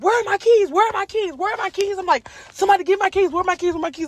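high, frantic repeated cries for keys; frantic freak-out. Retold or imitated. Daughter after the crash call, frantically hunting for her keys, high. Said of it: "full freaking freak out mode".

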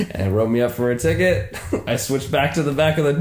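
usual speaking voice

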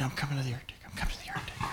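barely-there whisper; whispered thought. Retold or imitated. Wife in the truck thinking about Arctic trip, whispered. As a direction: whisper it like a private inner voice. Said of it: "she's thinking".

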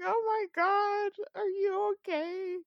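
high, loud alarmed question; alarmed friend. Retold or imitated. Dream friend hears about the wolf, alarmed and high.

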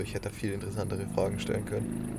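ordinary talk, nothing marked

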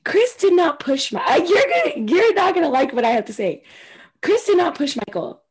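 excited insistence; emphatic. Real, not acted. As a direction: set the record straight, worked up. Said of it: "Give us your thoughts on this".

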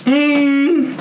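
pained laughing, voice strained; gasping through the burn; mouth burning from spicy food. Real, not acted. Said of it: "my tongue is burning".

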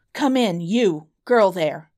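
loud call to the window. A narrator's reading. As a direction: call the girl in loudly. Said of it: "he cried".

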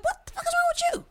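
high-pitched, incredulous demand; exasperated challenge. Retold or imitated. Woman challenging her ex's attitude, exasperated and high. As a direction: replay a fed-up outburst up high.

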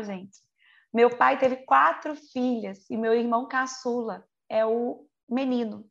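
normal talking voice

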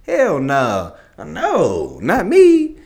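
high, loud shocked protest; shocked denial. Retold or imitated. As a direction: recoil from the accusation, voice jumping up. Denying the pimp accusation.

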